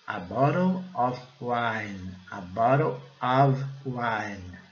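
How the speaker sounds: very slow "A bottle of wine", silent E in wine; slow pronunciation demo. Shown as a demonstration. Teacher models the phrase, slow careful English. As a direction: space out "A bottle of wine", holding the final N. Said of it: "o E não é pronunciado".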